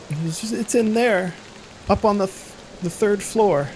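put-on a girl explaining her parents vanished; frightened little girl. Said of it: "the little girl points".

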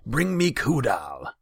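high, loud, drawn-out order; imperious royal yell; shouted command. A narrator's reading. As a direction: bellow for him to be fetched. Khan shouts command from throne.

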